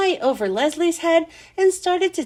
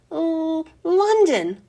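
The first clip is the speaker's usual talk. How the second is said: hesitant, higher-pitched nervous answer; 4 semitones higher, much slower than usual. A narrator's reading. Threatened girl answers her teacher's quiz question, nervous. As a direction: drag out the hesitation, then answer timidly. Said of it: "Leslie said nervously".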